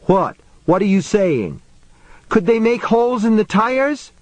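high incredulous questions; alarmed questioning. A narrator's reading. Man questioning frightened woman about the creatures, alarmed.

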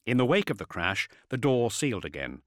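plain reading voice

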